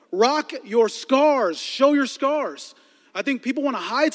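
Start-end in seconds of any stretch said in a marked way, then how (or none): 0.1-2.7 slow, deliberate slogan. Acted. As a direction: spell it out like a billboard. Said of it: "billboard slogan".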